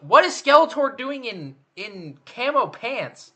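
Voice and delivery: loud, high-pitched disbelief; astonished question, voice shooting up; incredulous outburst. Real, not acted. Spots Skeletor oddly dressed in picture.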